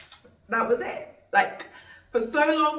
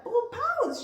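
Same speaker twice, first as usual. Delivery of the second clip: much higher, delighted announcement; excited reveal. Retold or imitated. Doctor telling the mother the weight, delighted. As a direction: squeal out the weight.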